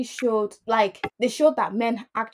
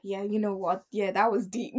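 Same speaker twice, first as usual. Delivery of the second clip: softer, solemn yeah; mock deep tone; 2 semitones lower, 8 dB quieter than usual. Hosts turning serious after hype, soft and solemn. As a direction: drop into a serious, thoughtful hush. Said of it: "and then, like".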